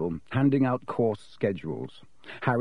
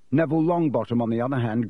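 ordinary delivery

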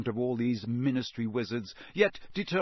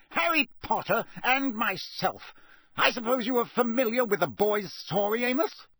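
stern cold anger; 10 semitones higher than usual. A narrator's reading. Crouch rebuking Diggory, cold and angry. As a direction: drive the point home. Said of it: "barked Mister Crouch".

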